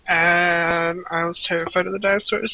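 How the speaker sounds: usual speaking voice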